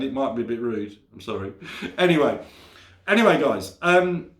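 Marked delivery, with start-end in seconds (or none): none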